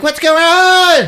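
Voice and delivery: high, loud, slow; startled teen coming downstairs; alarmed girl. Acted. Daughter comes down to chaos.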